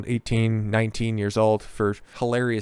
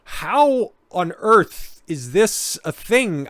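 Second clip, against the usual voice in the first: slow, higher-pitched disbelief; incredulous; 7 semitones higher, much slower than usual. Retold or imitated. Host reacting to a startling article, incredulous. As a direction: draw it out in baffled amazement.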